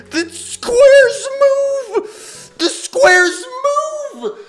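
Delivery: very high, loud, drawn-out shriek; shocked yelling. Real, not acted. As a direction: scream it in horrified disbelief. Shocked by moving game obstacles.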